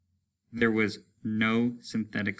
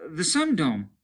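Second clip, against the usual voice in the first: raised, eager announcement; excited; 8 semitones higher, 4 dB louder than usual. Acted. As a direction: point it out with relief.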